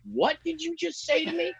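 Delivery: high, indignant mock challenge; offended butcher. Butcher taking offence at the comic asking for bones, mock indignant. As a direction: act insulted by the question.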